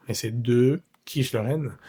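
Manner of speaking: "de" with rounded French e; "de quiche lorraine", French schwa on de. Shown as a demonstration. Teacher modelling "de" for students, deliberate. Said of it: "pronunciamos bien el de a la francesa".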